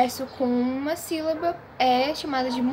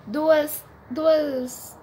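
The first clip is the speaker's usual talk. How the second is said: duas slowed and louder; slow emphatic demo; 5 dB louder than usual. Shown as a demonstration. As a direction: spell out duas slowly and loudly. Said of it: "consoantes se separam". (duas devagar e enfático)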